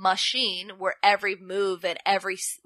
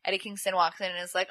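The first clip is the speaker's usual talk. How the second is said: ordinary talk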